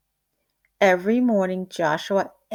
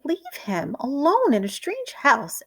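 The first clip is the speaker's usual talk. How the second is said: higher, louder indignant ask; indignant question; 6 semitones higher, 3 dB louder than usual. A narrator's reading. Mrs Prim confronts Valente, indignant. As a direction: demand it, outraged. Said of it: "asked Missus Prim".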